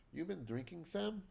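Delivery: put-on amateur Russian accent, pitch climbing at the end; bad Russian accent. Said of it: "I apologize for my bad Russian accent".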